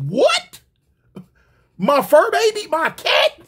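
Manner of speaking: high, loud incredulous questions; hammed-up indignant shock; outraged disbelief. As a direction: squeal it in offended shock. Acted outrage over the kicked cat.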